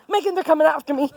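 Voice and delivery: fast, breathless warning; the birds are chasing her; panicked rush. Real, not acted. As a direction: blurt it out in panic.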